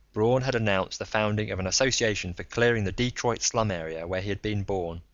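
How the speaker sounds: usual speaking voice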